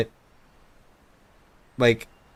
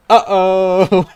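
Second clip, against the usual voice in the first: laughing uh-oh; 7 semitones higher, 8 dB louder than usual. Real, not acted. Laughing at the looming plot twist.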